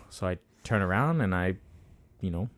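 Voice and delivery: ordinary delivery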